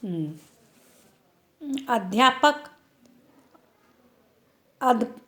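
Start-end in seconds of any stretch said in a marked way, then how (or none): none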